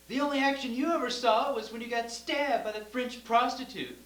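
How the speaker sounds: loud, high mock yell of a mother; mother yells from the kitchen at dad, mock shout. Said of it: "I'd hear my mother yell".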